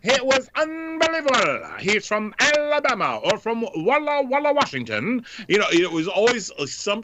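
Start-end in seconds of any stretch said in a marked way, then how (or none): 0.0-5.2 hammed-up Keith Jackson call, much higher; Keith Jackson impression. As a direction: paint the big man like a football broadcaster. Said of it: "I used to do Keith Jackson".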